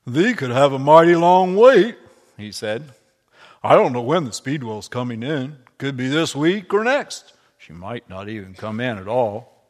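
lightly voiced hearty old miller. Miller answering Obadiah, lightly voiced hearty old man.

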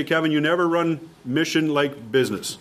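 normal talking voice

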